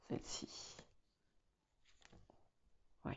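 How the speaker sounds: barely audible whisper; murmuring to oneself. Real, not acted. Reader choosing a card, whispered to self. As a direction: whisper it privately, very softly.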